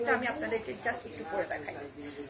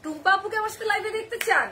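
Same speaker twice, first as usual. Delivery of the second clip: surprised; 8 semitones higher, 4 dB louder than usual. Real, not acted. Reacting to a viewer's comment.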